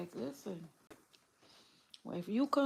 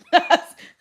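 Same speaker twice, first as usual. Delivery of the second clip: burst of laughter; 9 semitones higher, 14 dB louder than usual. Real, not acted. Laughing at her own joke.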